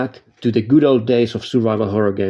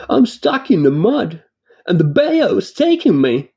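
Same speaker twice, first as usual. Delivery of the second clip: Jeremy Hartwood impression; 8 semitones higher than usual. Narrator mocking a game character's ridiculous voice, high hammed-up Southern. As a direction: ham it up like a bad voice actor. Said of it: "his voice sounds like this".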